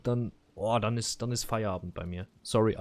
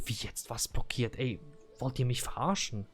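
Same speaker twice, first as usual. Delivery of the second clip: softer, half-whispered disbelief; stunned disbelief; 7 dB quieter than usual. Retold or imitated. Customer retelling his stunned reaction to the clerk, softer. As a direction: hiss it in quiet disbelief. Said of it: "dann wurde ich halt einfach stinkwütend".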